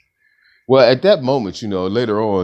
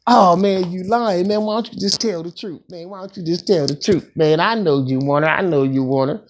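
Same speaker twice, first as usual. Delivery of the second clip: higher, insistent friend voice pressing him; mock pushy buddy demanding the truth; imitating accusing homeboy; 8 semitones higher than usual. Friend calling him a liar.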